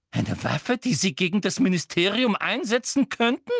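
high, loud, agitated questioning; excited interrogation. A narrator's reading. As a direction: pile up questions, working yourself up. Umbridge thrilled by the secret weapon.

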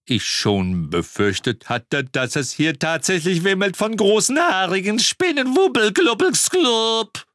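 squeaky high giddy babbling; rising into high-pitched nonsense gibberish; drugged babble. Acted. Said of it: "blinzelte immer schneller".

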